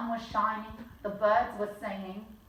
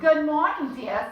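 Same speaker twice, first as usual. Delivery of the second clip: mother's greeting; 5 semitones higher, 6 dB louder than usual. Acted. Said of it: "said my mother".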